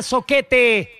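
usual speaking voice

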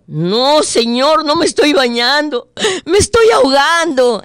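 high, loud panicked reply; drowning man's panicked answer; desperate. Acted.

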